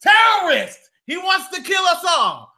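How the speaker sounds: high, loud alarmed shouting; imagined shouting in the store, loud; mock yell. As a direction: raise the alarm, hammed-up. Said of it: "I would be like".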